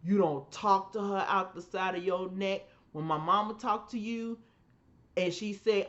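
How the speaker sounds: ordinary delivery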